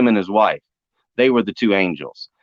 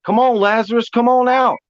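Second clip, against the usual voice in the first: calling out; 10 semitones higher, 3 dB louder than usual. Retold or imitated. Calling into the falls.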